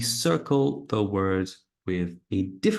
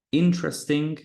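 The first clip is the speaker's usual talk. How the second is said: INteresting, first syllable higher; stress on first syllable; 6 semitones higher, faster than usual. Shown as a demonstration. Teacher showing word stress. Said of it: "that's one".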